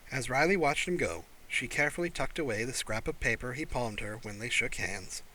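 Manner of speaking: plain reading voice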